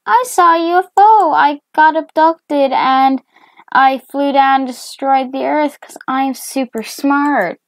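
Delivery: high, loud, rapid announcement; high-pitched, hurried bragging; excited boast. Acted. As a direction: blurt it out proudly at a high pitch. Acting out own boast to dad.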